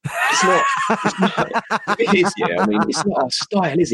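real laughter, voice pitched high; laughing through words. Real, not acted. Host answering co-host about giving a good shoeing, laughing. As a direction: talk helplessly through laughter.

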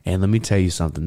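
ordinary delivery

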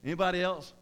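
normal talking voice

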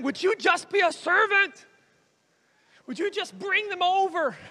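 high, forceful appeal; urgent pleading. Retold or imitated. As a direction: plead loudly in Jesus's role, exasperated. Jesus pleads with silencing believers.

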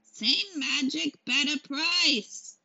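high, very slow crone voice; creaky old witch selling charms; old hag. Acted. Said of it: "said the hag".

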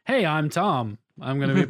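put-on American introduction; American accent. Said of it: "who thought he was American".

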